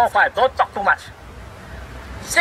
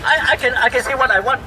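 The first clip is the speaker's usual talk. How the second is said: normal talking voice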